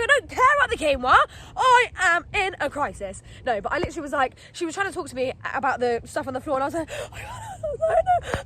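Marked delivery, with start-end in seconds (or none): 0.0-3.2 high, loud, emphatic protest; re-enacted exasperation. Re-enacts snapping at helpers. 6.9-8.4 very high, drawn-out mock wail; squealed, retold bawling; hammed-up crying. Re-enacts crying to the helper.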